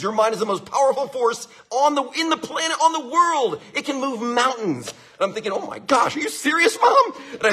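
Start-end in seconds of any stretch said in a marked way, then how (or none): 0.0-4.9 higher, swelling with belief; mom's rising encouragement. Retold or imitated. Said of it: "My mom goes". 5.5-7.1 high, climbing to a shout on Mom; a boy stunned with excitement; amazed kid shout. Retold or imitated. Boy amazed by mom's claim.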